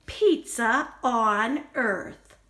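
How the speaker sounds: usual speaking voice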